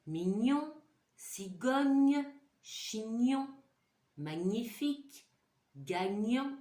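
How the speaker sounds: words again with a bright "gn" in "chignon" and "gagnant"; lively repeat. Shown as a demonstration. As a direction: make the gn jump out for the class. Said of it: "c'est le son".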